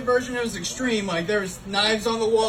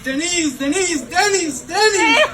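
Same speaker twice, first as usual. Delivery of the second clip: mock calling out; 5 semitones higher, slower than usual. Shot in knees, calling out.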